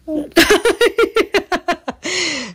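long high-pitched giggling; helpless high laugh; real laughter. Real, not acted. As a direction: burst into a high, sustained laugh. Child says no to viewers.

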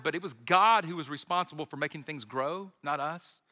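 ordinary talk, nothing marked

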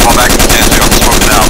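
urgent shouted order; shouting. Real, not acted. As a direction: yell it over gunfire. Combat callout under fire.

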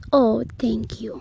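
ordinary talk, nothing marked

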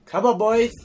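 loud, high rallying shout; calling out. Real, not acted. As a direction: shout to urge the lads on. Calling on teammates mid-game.

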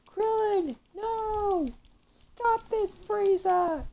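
high, loud anguished cry of a grieving hero; imitating Goku's cry. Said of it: "And he went".

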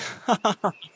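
high, loud mocking giggle; brief mocking cackle. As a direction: giggle at her weak attack. Mad woman mocks.

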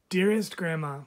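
ordinary delivery, nothing marked

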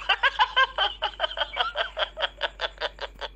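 high, long mocking laugh; high scornful cackle. Real, not acted. Debate partner laughs mockingly.

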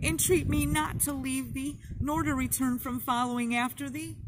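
cling and beg through tears; desperate sobbing version of Ruth's vow; tearful entreaty. A narrator's reading. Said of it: "sobbing over and over again".

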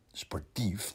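sportief zacht en twijfelend, met een vragende stijging; zacht en spottend. Acted. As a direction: mompel sportief alsof je het niet gelooft. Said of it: "denkt hij". (Rientje denkt het zacht en spottend)

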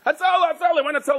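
high frantic cries for the ambulance; mock panicked calling; calling for help. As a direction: call out high and urgent.

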